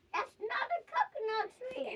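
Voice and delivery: trailing echo whisper. Acted. Hippo's warning echoing in a whisper. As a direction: fade it out like an echo.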